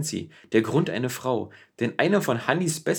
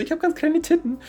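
put-on much higher, squeaky girlish quote; mocking high voice. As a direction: parody her in a high squeak. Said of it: "hat dann gesagt, so".

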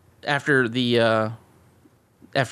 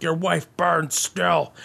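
villain taunt; slower than usual. Retold or imitated. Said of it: "He's like".

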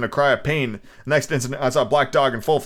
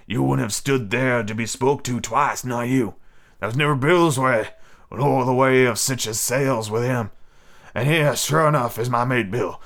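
sly pirate. Acted. Stranger toasting his mate Bill, slow pirate voice. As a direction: drawl it like a scheming sailor. Stranger speaks to boy at length.

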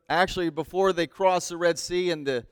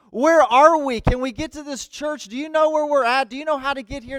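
frantic asking; 6 semitones higher, 4 dB louder than usual. Acted. Lost, asking farmers for directions.

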